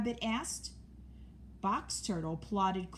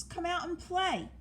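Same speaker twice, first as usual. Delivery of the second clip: calling out; 10 semitones higher than usual. A narrator's reading. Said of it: "called Mouse".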